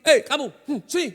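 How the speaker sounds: loud, high, short beckoning call; curt summons. Acted. Boss calling a worker who dislikes being called that way, curt summons. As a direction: summon someone rudely, like an underling.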